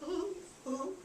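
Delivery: soft, high acted whimper; two small pretend sobs; quivering-chin whimper. Rachel's chin trembles after the scolding, soft mock whimper. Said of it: "chin went".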